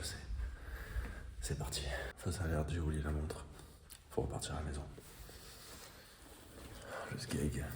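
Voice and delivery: hushed whisper so nobody wakes; breathy low whisper at home. Real, not acted. Filming quietly at home, unvoiced.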